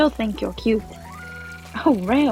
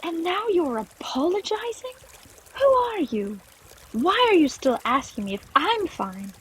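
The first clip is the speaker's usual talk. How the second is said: incredulous, high start turning accusing; agitated, hurt reproach; upset outburst; 13 semitones higher than usual. Acted. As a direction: confront an ex, fed up. Clover's outburst at her ex.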